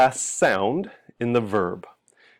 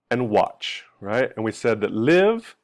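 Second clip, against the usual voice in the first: ordinary talk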